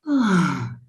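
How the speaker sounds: audible voiced sigh sliding down in pitch; sigh with a sound. Shown as a demonstration. Coach shows how to release tension, voiced sigh. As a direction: let the breath out in a long falling "ahh". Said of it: "they can sigh with a sound".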